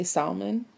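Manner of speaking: "salmon" with the L sounded; "salmon" said sal-mun, the L spoken. Retold or imitated. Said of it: "pronounce the L in the word salmon".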